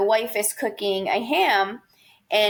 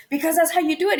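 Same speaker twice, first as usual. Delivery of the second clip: higher-pitched acted insistence; insistent retort; 7 semitones higher, much faster than usual. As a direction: insist as if it were obvious. Acted insistence in ham story.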